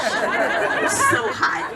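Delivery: high, slow, drawn out; exaggerated slow complaint. Comic telling the crowd how hot it was, slow and high.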